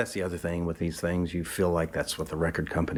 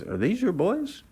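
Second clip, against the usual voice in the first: much higher imitated woman's voice; matronly woman; 13 semitones higher than usual. The woman asking Charlie about the boys, in a high put-on voice. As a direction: ask it like a chatty older lady. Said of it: "She goes".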